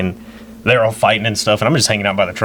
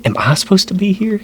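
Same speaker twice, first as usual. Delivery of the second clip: much higher, retold incredulous question; baffled disbelief; 11 semitones higher than usual. Nephew doubting he should be there, baffled. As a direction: ask it high-pitched, as if bewildered.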